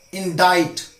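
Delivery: "Indict" with no c, long i in the ending; silent C in indict. Shown as a demonstration. Teacher shows a tricky word, "Indict" said in-DITE. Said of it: "now some tricky ones".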